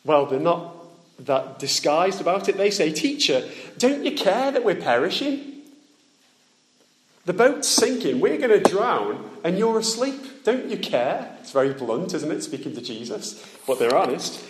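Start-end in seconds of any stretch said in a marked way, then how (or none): 2.9-5.6 high, desperate plea over the storm; panicked cry. Acted. Disciples begging Jesus in the sinking boat, high and desperate. 7.2-11.4 panicked cry. Acted. Disciples cry out in storm.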